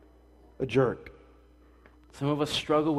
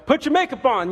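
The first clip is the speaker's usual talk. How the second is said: higher, louder rushed yell; mock yell at wife; 10 semitones higher, 7 dB louder than usual. Pastor imitating a husband yelling at his wife, loud mock yell. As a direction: bark it at your wife to hurry. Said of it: "yelling at your wife to hurry up".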